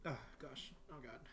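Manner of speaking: talking through hiccups; hiccuping. Real, not acted. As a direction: catch on a hiccup between words. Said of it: "I'm hiccuping".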